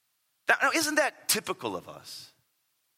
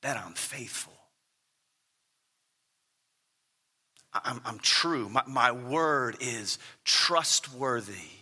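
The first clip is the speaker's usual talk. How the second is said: tender assurance; 5 semitones lower than usual. Real, not acted. Tender affirmation of God's faithfulness.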